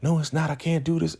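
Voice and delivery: high-pitched retold panic; freaking out. Zane rejects Ruth's reassurance, freaking out. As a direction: cut in frantically, pitch way up, imitating distress. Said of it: "Zane is freaking out".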